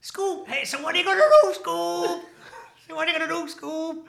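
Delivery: very high, loud cartoon voice; Shaggy from Scooby-Doo. Repeating the party-trick impression for the podcast, high cartoon voice. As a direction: call out the catchphrase in a squeaky cartoon pitch. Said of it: "Shaggy from Scooby-Doo is like".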